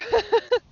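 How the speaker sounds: quick high amused laugh; short laugh. Real, not acted. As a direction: let out a brief chuckle. Amused at tractor joke.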